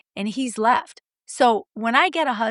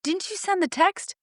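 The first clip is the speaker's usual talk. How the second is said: mock surprise; 8 semitones higher than usual. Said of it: "I'll be like".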